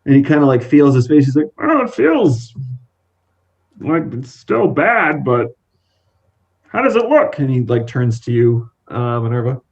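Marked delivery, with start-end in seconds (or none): 1.6-7.4 anxious. Acted. Modi feels his cracked face.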